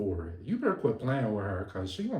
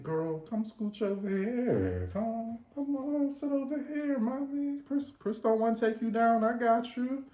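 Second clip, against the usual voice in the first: coaxing invite; 10 semitones higher than usual. Retold or imitated. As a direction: lure her over, charming. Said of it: "he told her".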